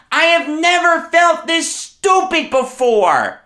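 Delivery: high-pitched shouting tirade; furious rant. Real, not acted. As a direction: yell in outrage, punching the key words. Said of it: "I'm beyond furious".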